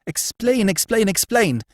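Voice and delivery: high, loud, insistent repetition; impatient demand. Acted. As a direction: stamp each explain out, demanding. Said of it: "tapping the floor with her foot".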